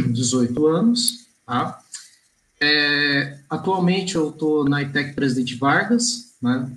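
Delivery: usual speaking voice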